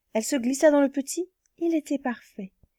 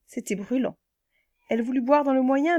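usual speaking voice

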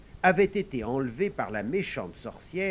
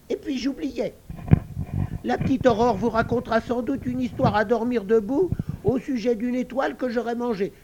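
high, louder squeaky pig voice, chatty and wheedling; sly piglet; 10 semitones higher, 6 dB louder than usual. Acted. Little pig warns the girls not to believe Aurore, high and sly. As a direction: piglet talking his way out of a lie.

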